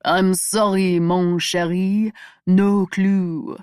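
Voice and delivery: Michael mimics Sabine's French accent to tease Jenna; mocking French accent. Michael teasing Jenna, imitating Sabine in mock French accent. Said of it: "in a mocking French accent".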